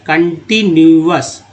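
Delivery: Continuous slowly split as con-ti-nu-ous; syllable by syllable. Shown as a demonstration. Teacher showing the parts to learners, continuous slow and divided. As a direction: draw out each part of continuous. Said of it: "तो कन एक part है".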